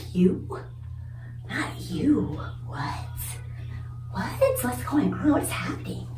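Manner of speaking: hushed, gasping disbelief; mock shock. Office staff shocked it was her, hushed mock disbelief. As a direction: act stunned in a half whisper. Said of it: "everybody was shocked".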